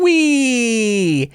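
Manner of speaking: put-on high gleeful kid whoop; a joyful child's cry in a high voice; kid on a griffin. Kid riding a griffin whoops in a high voice.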